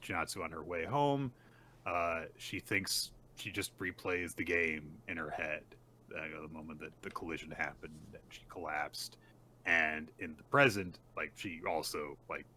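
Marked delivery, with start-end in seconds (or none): none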